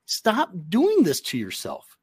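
a fan groaning at his own team's penalties; frustrated fan pleading with his team; exasperated plea. Retold or imitated. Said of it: "you sit there and you go".

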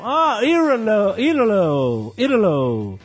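imitating an American saying Italo, stretched out; a light American accent drawing out the name, as if finally getting it; a hint of an American clerk repeating Italo. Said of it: "Aí eu escuto um".

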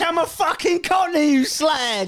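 Cockney mum shouting. Retold or imitated. Friend mocking James's mum as a screaming Cockney. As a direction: bellow like an EastEnders matriarch. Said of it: "Is your mum Peggy Mitchell?".

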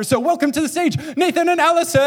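high, loud announcer build to the names; stage introduction shout. Real, not acted. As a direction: bring out the guests, stretching the last name. Calling guests on stage.

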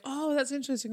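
high-pitched "oh, interesting" small talk; politely intrigued, pitched right up; feigned polite interest. Retold or imitated. Quoting polite small talk response.

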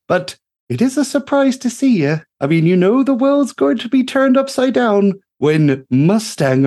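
put-on high and loud, teasing an old friend; chirpy tinkerer ribbing a rogue; high, bubbly gnome voice, playful; cheery gnome mechanic. GM voices Greta greeting Mustang.

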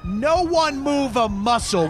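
slow, menacing villain command; hammed-up movie villain; villain threat. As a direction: freeze the hostages with a slow threat. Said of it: "the villainous Johns Gaber".

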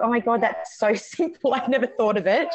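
laughing through a sudden realisation. Retold or imitated. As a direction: giggle at how simple it was. Retold delighted realisation, laughing.

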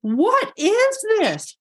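stunned disbelief at the table; baffled. Retold or imitated. Patient as the chiropractor walks away, in slow stunned surprise. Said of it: "And I'm like".

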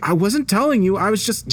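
very high, defensive stammer of an excuse; alarmed backpedalling; flustered protest. Acted. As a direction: plead innocence, pitched right up. Cut off by his angry boss.

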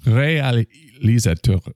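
exaggerated French accent. Shown as a demonstration. Host testing himself on a French word, exaggerated French accent. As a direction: stretch it out in an overdone French accent. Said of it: "see if you can do a French accent".

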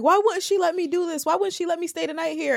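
put-on high whining teenager complaining about mom; whiny teen. Mimicking whining teen self to mom.

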